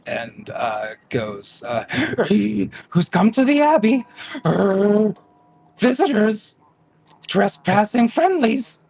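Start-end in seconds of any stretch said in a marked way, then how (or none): 1.9-2.8 sharp high gasp of surprise; startled gasp. Acted. GM voices startled creature. 2.9-8.8 high, loud, fast creature squawk. Acted. GM voices dog-donkey creature.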